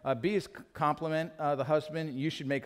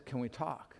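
gently ask, almost apologetic; humble ask; 2 semitones lower, 8 dB quieter than usual. Retold or imitated. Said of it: "in a humble way".

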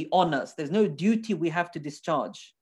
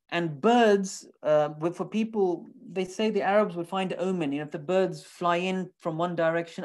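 ordinary delivery

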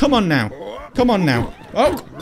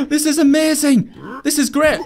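high, gleeful exclamations; thrilled and pitched up; delighted; 6 semitones higher than usual. Real, not acted. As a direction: gush with delight at a new toy. Delighted with the new weapon.

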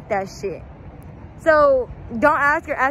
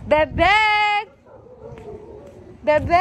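calling out; 6 semitones higher than usual. Acted. Calling out again.

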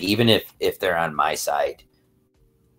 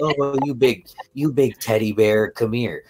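higher, louder mock fawning; cooing women; 7 semitones higher, 5 dB louder than usual. Imitating women fussing over large yelling men, cooing. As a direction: coo at a big cuddly teddy bear. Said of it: "women find that attractive and cute and cuddly".

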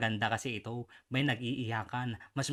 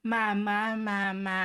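mock mama's boy call; 6 semitones higher, 4 dB louder, much slower than usual. Mocking the senator's mama talk.

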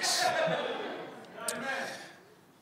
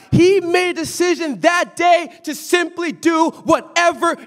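rising, emphatic sermon call pushed louder; preaching; 8 semitones higher, 5 dB louder, slower than usual. Acted. Preacher urging the congregation to want change, emphatic sermon voice. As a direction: drive it home like a pulpit climax.

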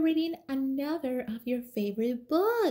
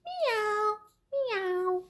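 cat meow; 11 semitones higher than usual. Acted. Cat meows.

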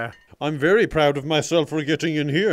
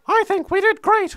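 much higher silly voice for the little guy; high cartoon sidekick voice; squeaky character voice; 14 semitones higher than usual. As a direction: voice him as a tiny hero. Voicing buddy character.

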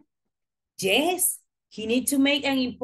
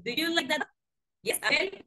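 normal talking voice

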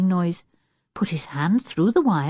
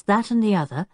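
ordinary delivery

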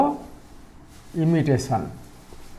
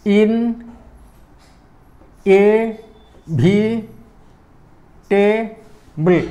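"inevitable" very slowly as in-e-vi-ta-ble, long gaps; "inevitable" one isolated syllable at a time; 2 semitones higher, much slower than usual. Shown as a demonstration. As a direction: break "inevitable" into five drawn-out syllables. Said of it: "Come to the third one".

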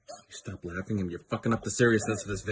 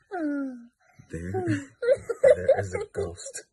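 very high, slow, laughing ghost wail; mock spooky wail; 7 dB louder, much slower than usual. Announcing the ghost to the camera, mock wail. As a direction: stretch it out high like a bad horror movie.

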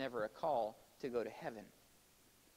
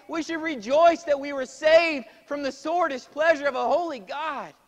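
high, very loud sermon delivery; full-force preaching; 13 semitones higher, 17 dB louder than usual. Real, not acted. Preacher urging his congregation to worship the Son, impassioned full-force preaching. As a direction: proclaim it high and loud, drawing out key words.

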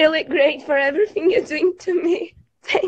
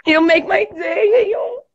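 tearfully happy, rising higher as it goes; overjoyed; 3 semitones higher, 4 dB louder than usual. Real, not acted. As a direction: gush with real thanks, overwhelmed. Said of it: "I'm really happy".

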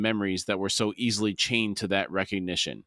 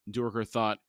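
ordinary delivery, nothing marked

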